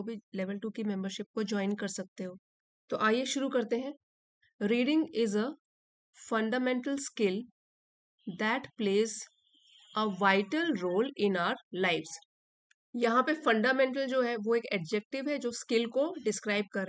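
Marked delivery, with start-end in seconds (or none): 4.6-12.1 slow, measured teacher's reading with pauses; dictation-pace reading, pausing between phrases; slow read-aloud. Acted. Reads the article aloud slowly.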